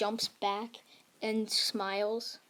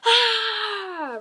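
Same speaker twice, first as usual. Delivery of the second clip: long, high, loud attack yell; full-out anime power scream, falling at the end; battle scream; 12 semitones higher, 11 dB louder than usual. Acted. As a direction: scream while unleashing a huge blast. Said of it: "flips over to the back of Frieza and says".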